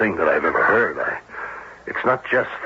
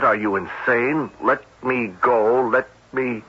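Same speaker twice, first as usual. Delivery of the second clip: a touch higher, slower plea read from paper; captain reads the victim's plea from the note, lightly acted; reading a quoted plea; 5 semitones higher than usual. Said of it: "The television said".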